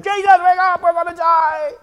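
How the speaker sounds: high loud terrified cry; mock panic. Disciples in the storm yelling at Jesus, panicked. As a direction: scream it like the boat is sinking.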